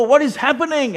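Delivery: mock wail. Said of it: "one crowd just crying and weeping".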